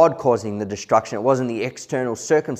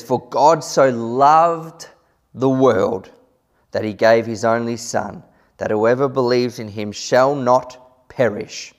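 solemn scripture reading; 2 semitones higher, 6 dB louder than usual. Acted. Reading John 3:16 with emphasis.

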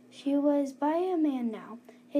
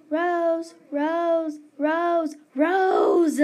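louder and higher each time, ending in a shout; building yell; 4 semitones higher, 7 dB louder, much slower than usual. A narrator's reading. Mom shaking the bed to wake her daughter, yelling. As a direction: call her name until you shout. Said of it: "my mom yelled".

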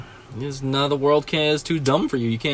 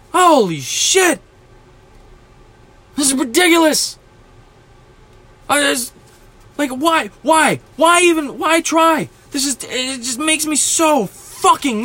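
frustrated shout; 9 semitones higher than usual. Real, not acted. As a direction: yell it out in despair at people.

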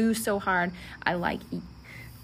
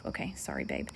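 sheepish aside; 10 dB quieter than usual. Real, not acted. Aside to husband.

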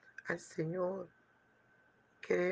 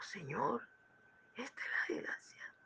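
breathless whisper to the Lord; awed whisper; 4 semitones higher, 7 dB quieter than usual. Acted. A man in heaven telling the Lord about the old woman's house, in a whisper. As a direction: whisper it in disbelief.

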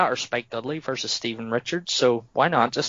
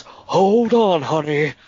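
higher and louder, a hammed-up smug "Hold on, honey"; hammed-up cocky wrestler; 4 semitones higher, 6 dB louder, slower than usual. Host re-quoting Fink's line to co-hosts, hammed-up and louder. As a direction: ham up the cocky heel line, really milking it. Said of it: "He goes".

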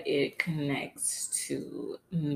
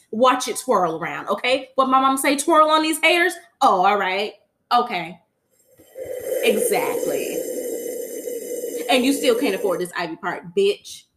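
put-on sassy child bragging; 3 semitones higher, 9 dB louder than usual. Still voicing sassy Blue.

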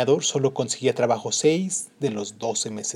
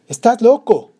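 wife's outburst; 9 semitones higher, 11 dB louder than usual. Acted. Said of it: "le dijo ella".

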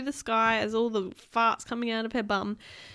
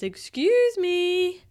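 high, loud, very drawn-out apology; sing-song it, stretching each word; exaggerated polite girl; comic apology; 8 semitones higher, 6 dB louder, much slower than usual. Girl excuses herself in fart clouds.

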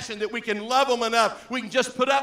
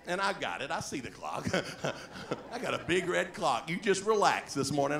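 ordinary talk, nothing marked

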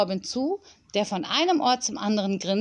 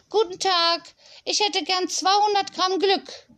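high, cheery customer voice; playful shop customer; 10 semitones higher than usual. Acted. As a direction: order politely in a put-on high voice.

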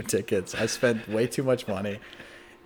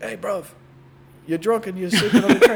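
higher, louder mock British wake-up call; jolly London accent rousing a drunk; British stranger; 8 semitones higher, 8 dB louder than usual. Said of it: "someone's like".

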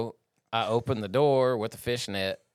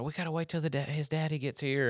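higher, soft mock of a tearful owner; retold tearful plea; 5 semitones higher, 5 dB quieter than usual. Vet retells crying monkey owner's plea, mock tearful. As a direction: lightly imitate a pleading pet owner. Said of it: "in tears, crying".

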